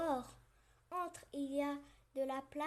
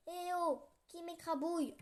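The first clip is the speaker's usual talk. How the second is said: grumbling; 5 semitones higher, 5 dB louder than usual. Acted. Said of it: "rouspète la grenouille".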